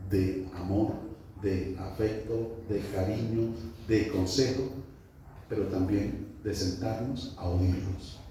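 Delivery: quiet reflective preaching. Real, not acted. (prédica pausada, en voz baja y grave)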